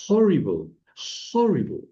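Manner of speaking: horrible in English with a strongly breathed initial H; English pronunciation. Shown as a demonstration. Teacher answering how it sounds in English, horrible with audible H. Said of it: "cómo suena horrible en inglés".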